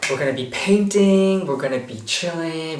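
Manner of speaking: high-pitched excited listing, easing off. Real, not acted. Traveler telling viewers the plans for painting and chilling, excited. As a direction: list the plans with giddy anticipation.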